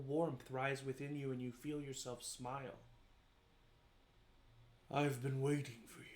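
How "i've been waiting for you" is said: a hint of a deep, resounding being. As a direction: lightly play a deep voice from a dream. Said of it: "you hear in a deep, resounding voice".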